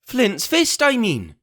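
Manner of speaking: high, impatient cry; angry cry. Acted. Pugh corrects himself at his men, angry. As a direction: snap the correction. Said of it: "he cried".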